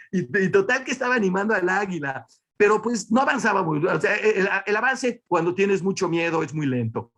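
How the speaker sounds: ordinary delivery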